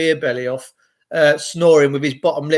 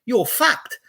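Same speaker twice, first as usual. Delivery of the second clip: mock Australian, higher pitched and drawn out; Australian accent; 6 semitones higher, slower than usual. Impersonating an Australian scolding someone, higher Aussie accent. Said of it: "they say".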